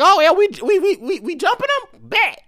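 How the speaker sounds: high, eager taunt; hyped bird joining in. Pretend. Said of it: "the other bird caught wind".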